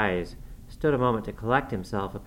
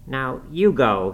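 slow, loud dismissal; angry order; 3 semitones higher, 6 dB louder, slower than usual. A narrator's reading. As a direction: order him out with drawn-out force. Furious master orders the young man out.

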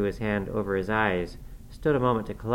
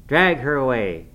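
loud, angry command; savage cry; 3 semitones higher, 7 dB louder than usual. A narrator's reading. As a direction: bark the order savagely. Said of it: "he cried savagely".

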